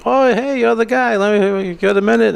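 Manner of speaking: high-pitched, eager approach; imitating eager customer. Pharmacy customer stopping the comedian, imitated eager approach. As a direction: accost someone you recognise, pitched well up. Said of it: "They want to say".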